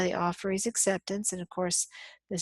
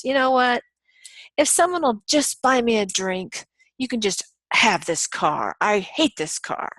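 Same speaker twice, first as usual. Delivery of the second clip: venting; 2 semitones higher, 6 dB louder than usual. Retold or imitated. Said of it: "as I'm venting to my friends".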